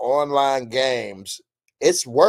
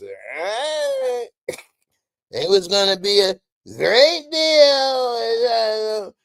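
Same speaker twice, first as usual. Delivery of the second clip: high, stretched Trump voice; hammed-up Trump announcing a deal; Trump impression; 8 semitones higher, 3 dB louder, much slower than usual. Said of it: "'cause Trump always do this".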